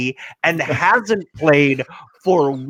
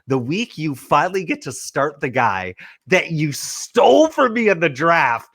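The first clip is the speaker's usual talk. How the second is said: ordinary talk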